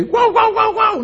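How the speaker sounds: high, playful mock barking; dog barking. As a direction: yap like a little dog greeting its owner. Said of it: "o un perrito ahí". (ladridos de perrito imitados, agudos)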